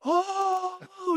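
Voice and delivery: very high imitated freak-out wail; mock panicked "oh" in a squeaky high voice; high-pitched gasp of panic. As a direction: wail high like a terrified tandem pilot. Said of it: "he's like".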